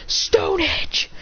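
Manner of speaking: high-pitched attack cry; battle command. Acted. Trainer ordering Lycanroc's final attack, high battle cry. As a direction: call out the move up high.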